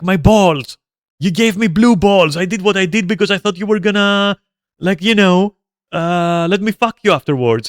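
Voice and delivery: imitating an aggrieved whiner, high and loud; pained outburst, retold mockingly; mock pained complaint.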